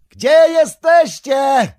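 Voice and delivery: loud, high, slow despairing cry; anguished yell for lost friends; desperate shout. Acted. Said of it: "zawołał zrozpaczony".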